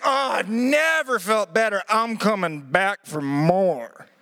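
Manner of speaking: slow, drawn-out strained voice; slow, hammed-up groaning satisfaction; battered patient. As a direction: play the man just put through the wringer. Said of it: "But he stands like".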